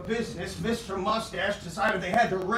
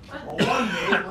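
laughing; 4 dB louder than usual. Real, not acted. Laughing mid-story.

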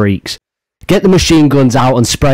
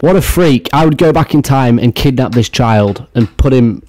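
ordinary talk

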